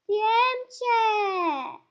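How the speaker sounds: high, drawn-out wailing threat; playful threat cry. Real, not acted. Player hunts a small fly, playful threat cry. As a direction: wail it long and high while chasing.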